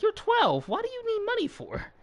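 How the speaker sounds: high-pitched mock disbelief; incredulous. Cousin balking at a twelve-year-old wanting money, incredulous. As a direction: pitch jumps way up, baffled retold question.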